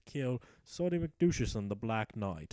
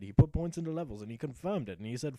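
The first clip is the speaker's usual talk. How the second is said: ordinary talk, nothing marked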